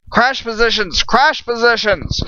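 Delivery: high urgent yell of a falling crew's captain; yelled order. Acted. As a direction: bark the order over the crash, slowing on the repeat. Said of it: "Hawk yelled out".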